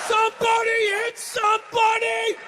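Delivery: big roaring rally cry, high and loud; game-day shout; pumped-up football yell. Pretend. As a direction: scream it like a linebacker before kickoff.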